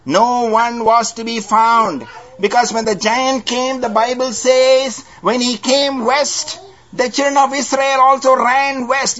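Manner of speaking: high, ringing preacher's voice; fervent pulpit delivery; preaching. Acted. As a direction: lift it up to the congregation. Preaching the Israelites' flight.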